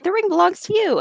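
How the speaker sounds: high, meek little Frodo; mock Frodo. Frodo offers the ring, in a high meek voice. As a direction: squeak it out like a timid hobbit. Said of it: "Frodo was like".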